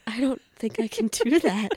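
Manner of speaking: high, gasping mock alarm; playful retold panic. Acts out panic at Skype invitation.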